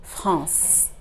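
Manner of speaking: France as one nasal syllable ending in S; silent final E. Shown as a demonstration. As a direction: end France on the "s" sound, French style. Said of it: "The next word on the screen".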